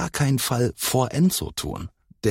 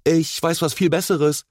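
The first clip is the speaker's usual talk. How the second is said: high, forceful interjection; insistent boy, pitch raised; energetic call; 9 semitones higher than usual. A narrator's reading. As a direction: push your idea in energetically. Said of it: "rief Enzo energisch".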